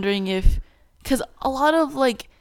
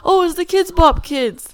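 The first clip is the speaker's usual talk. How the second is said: mock excited crowd; 9 semitones higher, 4 dB louder than usual. Host mimics audience at a kids' concert, mock excited. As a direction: imitate a crowd's excited squeal.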